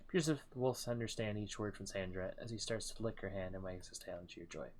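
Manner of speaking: usual speaking voice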